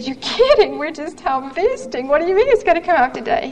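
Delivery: high, quick startled protest; can't believe what the doctor just said; shocked disbelief. Retold or imitated. Patient to her doctor, stunned disbelief. Retold reply to doctor's news.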